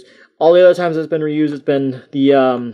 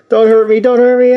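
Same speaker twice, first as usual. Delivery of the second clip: mock scared plea; 7 semitones higher, 5 dB louder than usual. Toy falling over, mock plea.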